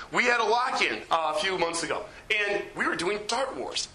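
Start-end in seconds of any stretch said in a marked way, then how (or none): none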